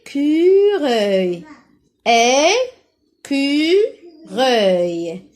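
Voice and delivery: "Écureuil" very slow, é-cu-reuil; syllable by syllable. Shown as a demonstration. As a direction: stretch each of the three syllables of "Écureuil". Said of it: "Première syllabe".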